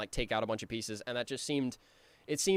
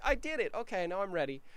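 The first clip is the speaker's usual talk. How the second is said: higher, bright little pleased voice; proud sweet Billy; 7 semitones higher than usual. Retold or imitated. Billy pleased after his winning move, proud little voice. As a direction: play it pleased with himself.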